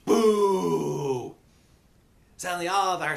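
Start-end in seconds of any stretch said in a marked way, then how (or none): 0.1-1.4 long, loud boo; jeering crowd. Acted. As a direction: jeer like a hostile crowd. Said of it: "from cheering to jeering".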